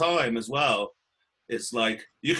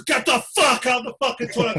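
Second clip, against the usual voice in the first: mock yell; 7 semitones higher, 4 dB louder than usual. Retold yelling at guy in toilet.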